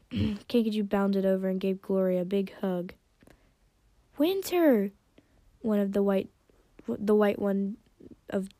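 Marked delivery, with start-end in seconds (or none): 4.2-4.9 high, excited greeting; thrilled high-pitched hello; happy call. A narrator's reading. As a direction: cry the name on spotting him. Daughter runs over to hug him.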